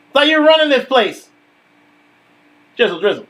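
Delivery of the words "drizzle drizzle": low catchphrase. Acted. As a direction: drop lower on drizzle drizzle.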